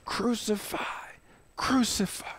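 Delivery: hushed whisper of the mob; haunting whispered echo of the mob; whispered crowd. Acted. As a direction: breathe the words like a distant crowd. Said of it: "And they're yelling".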